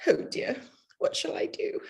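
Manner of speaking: despairing whisper. Acted. Bride despairs to her family about the dress, whispered. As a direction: breathe it out hopelessly, no voice.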